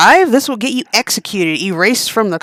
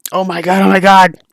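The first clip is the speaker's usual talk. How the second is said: louder panicked oh my god; alarmed cry; 6 dB louder than usual. Acted. Realizing she caused the cancer, loud alarm. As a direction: let the shock break out.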